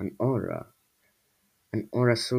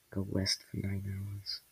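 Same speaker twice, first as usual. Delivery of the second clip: slow, very soft mutter; 10 dB quieter than usual. A narrator's reading. Luffy advises the upset squall, muttering. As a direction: mutter it slowly under your breath. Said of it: "muttered Luffy".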